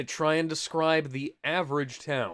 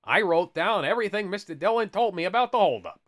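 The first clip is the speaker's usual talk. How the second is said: higher, hammed-up comic dad; exaggerated storybook father; funny character voice; 6 semitones higher, 4 dB louder than usual. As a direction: declare it in a silly raised voice. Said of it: "can you read this in a funny voice".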